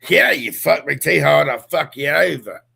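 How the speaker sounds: Australian woman imitated with a broad accent; mock Australian accent. Co-host imitating how Australian women sound, mock Australian accent. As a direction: put on a rough Australian accent. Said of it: "even the women sound like".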